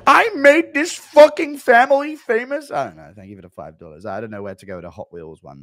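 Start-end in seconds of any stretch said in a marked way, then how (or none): 0.1-2.7 high, loud, slow whiny wail; mock crying Schmitty. Schmitty whining at mum over Hot Wheels, high loud wail. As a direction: whine it like a child denied a toy. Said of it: "Impression of Schmitty crying".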